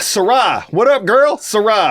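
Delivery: loud, high greeting called out; excited shout-out. Real, not acted. Host greets a fan on the podcast with loud excitement. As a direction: hype her up by name. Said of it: "I told you I'd say your name".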